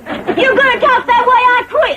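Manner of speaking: high-pitched offended outburst; indignant. Acted. Insulted woman threatening to quit, indignant. Quarrel after being called dumb.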